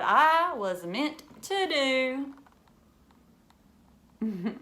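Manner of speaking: lively storybook reading for a class; reading to children. Acted. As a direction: read it like a picture book to kids. Teacher reads picture book aloud.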